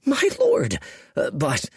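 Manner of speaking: high, strangled and nervous; stammering, choking on the words; nervous lieutenant chokes out his objection; choked. A narrator's reading. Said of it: "choked Spiketail".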